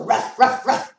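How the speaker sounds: loud, sharp dog barks. Pretend. Reader showing how dogs go, barking. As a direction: bark it out loudly in short bursts. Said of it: "Dogs go".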